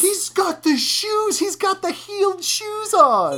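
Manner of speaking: crowing over the reveal in delight; excited. Real, not acted. Telling her co-host about the heeled shoes, thrilled and high. Gleeful about the father's outfit.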